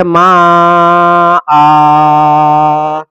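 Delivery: ما آ with the آ held long; stretched vowel. Shown as a demonstration. Teacher giving correct reading, long drawn vowel. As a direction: draw out آ slowly. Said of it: "صحیح تلفظ اس کا یہ ہے".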